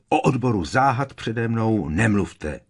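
forceful, forbidding interruption; menacing. A narrator's reading. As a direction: cut them off threateningly. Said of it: "zarazil ho hrozivě Harry".

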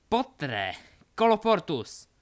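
loud, high-pitched incantation; shouted spell. Acted. Delphi seals the doors in a duel, shouted. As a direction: shout the spell with full force.

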